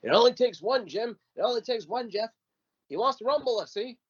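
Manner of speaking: high, pleading ringside coaching; frantic cornerman. Retold or imitated. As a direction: beg your fighter to land one, high-pitched. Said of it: "Corbett is losing his mind ringside".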